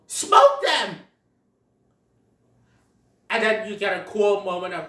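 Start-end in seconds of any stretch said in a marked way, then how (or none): 0.1-1.0 higher, louder yelled battle cry; gleeful battle cry. Retold or imitated. Possums charging at Sid and Diego, gleeful yell. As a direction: whoop it as you charge. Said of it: "they go".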